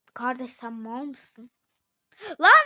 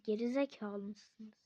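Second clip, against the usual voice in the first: ordinary delivery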